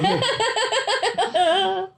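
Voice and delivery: long, high, loud cackling laugh; real hearty laugh. Real, not acted. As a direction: crack up loudly and high. Cracking up at pull quote joke.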